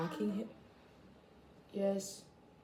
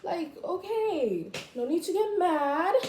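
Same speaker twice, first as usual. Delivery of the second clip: mock soothing; 8 semitones higher, slower than usual. Continues mocking taunt.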